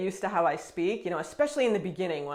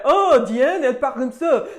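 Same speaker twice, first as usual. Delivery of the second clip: loud, higher, laughing mockery; mocking and laughing, louder and higher; mocking French person; 5 semitones higher, 11 dB louder than usual. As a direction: laugh at her and mock her loudly. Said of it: "a French person will say".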